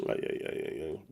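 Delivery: breathy whispered "ay, ay, ay"; whispered lament. Pretend. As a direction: whisper it like a mock dirge. After laughing at the player's loss.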